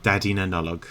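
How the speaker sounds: "Daddy Nanulog" with a hard T onset, Irish stress on "Daddy"; Irish Santa name. Shown as a demonstration. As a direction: say "Daddy Nanulog" as Irish speakers do, "tæd-ee NUN-uh-log". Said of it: "That is actually full-on Irish speak there".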